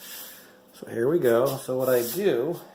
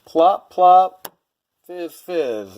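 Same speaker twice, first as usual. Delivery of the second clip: playful sound effect; 6 semitones higher, 6 dB louder, much slower than usual. Pretend. Imitating tablet dropping in jar.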